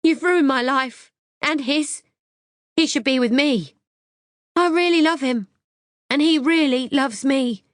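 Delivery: high, loud, desperate shouting; a jilted lover yelling after her rival; screaming. A narrator's reading. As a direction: rage and heartbreak poured out loudly. Liz screaming after leaving rival.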